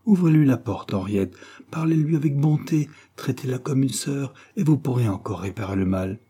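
usual speaking voice

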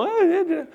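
very high, timid little grunts; mock a half-hearted clap; mock feeble clap. Shown as a demonstration. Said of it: "You don't just do a little".

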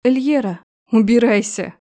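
loud angry shout. Acted. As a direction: yell it out to drive her away. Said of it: "крикнув".

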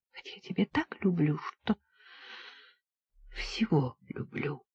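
a soft, breathless whispered confession. A narrator's reading. Polina whispers her love to Georgy, hushed whisper. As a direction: whisper it close to his ear.

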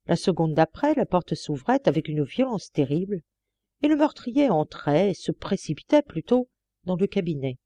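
plain reading voice

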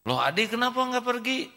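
put-on high, surprised little child; child's voice. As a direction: ask like a puzzled small child. Child Ade asks. (suara anak kecil heran)

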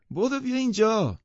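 high, loud call from the window; excited high call to the window. A narrator's reading. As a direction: shout across the room for him to come. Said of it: "دیزی از کنار پنجره فریاد زد".

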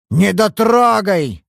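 "Недотрогой!" snorted slowly and high in scorn; snorting. Acted. Old father snorting at his daughter's word, scornful "Недотрогой!". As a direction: throw "Недотрогой!" back with a slow derisive snort. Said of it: "фыркнул Майер".

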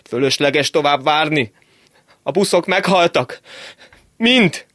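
put-on panicked shouting young man; breathless shout. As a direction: scream the news while running past. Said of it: "zilált, elfuló hangon azt kiáltotta".

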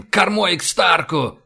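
slow, high, hoarse shout; croaked order. A narrator's reading. Admiral turning the stern to Stark, croaking loudly. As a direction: rasp the command, stretching each word. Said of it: "прохрипел Рашен".

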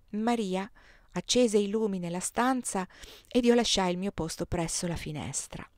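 plain reading voice

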